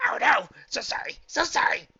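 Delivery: high, loud frightened diamond dog; terrified. A narrator's reading. Cowering dog to the angry archaeologist, terrified. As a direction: burst out with panicked, pleading apologies. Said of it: "fighting to contain terror".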